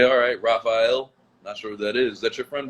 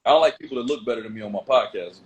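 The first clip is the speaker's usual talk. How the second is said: normal talking voice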